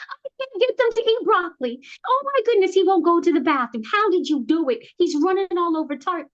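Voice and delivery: high-pitched imitation of a frantic parent; mock-panicked parent pleading for help; frazzled parent. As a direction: wail it like a parent at wit's end. Voices overwhelmed parents asking for help.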